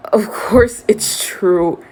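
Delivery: slow, resigned and a touch bitter; drawn-out sad certainty; bitter acceptance. A narrator's reading. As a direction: concede it like a known fact. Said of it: "almost bitterly".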